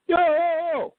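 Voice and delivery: very high alarmed protest; retold protest to the cop searching pockets. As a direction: yelp it like stopping a search. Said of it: "I go".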